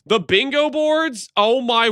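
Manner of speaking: high, slow surprised gasp; sudden realization. Real, not acted. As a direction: realize it all at once. Suddenly remembers the bingo boards.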